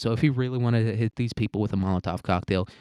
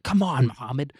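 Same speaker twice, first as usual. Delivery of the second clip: high-pitched exasperated mock yelling; yelling handler; 9 semitones higher, 3 dB louder than usual. CIA handler berating Mohammed for botching the attack, mock exasperated yelling. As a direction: act out an incredulous, fed-up handler chewing someone out. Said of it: "his handler's yelling at him".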